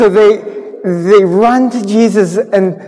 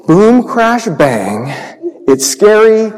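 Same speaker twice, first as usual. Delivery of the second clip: ordinary talk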